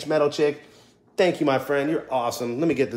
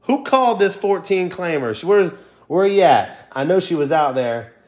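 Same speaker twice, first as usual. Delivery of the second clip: excited shouting; 6 semitones higher, 6 dB louder than usual. Real, not acted. Asking who called the win.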